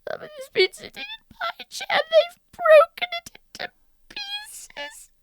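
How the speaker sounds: put-on very high fast squeaky baby bear; very high rushing baby voice. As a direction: squeak it like a tiny cub. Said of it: "And Baby Bear said".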